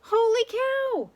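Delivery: very high, loud, slow holler; even bigger holler. A narrator's reading. Manny the cook hollers his thought, very loud and high.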